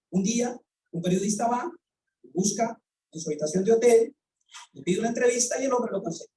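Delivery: ordinary talk, nothing marked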